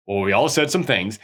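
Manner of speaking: low, lightly imitated backpedal; mock de-escalating. Caught liar to his angry companions, mock de-escalating. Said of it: "he tries to de-escalate the situation".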